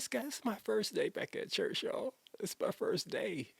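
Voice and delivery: high, strained voice cracking with tears; breaking down. Retold or imitated. Pastor recalling breaking down to Scott on his first day back at church, voice cracking. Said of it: "And I broke down".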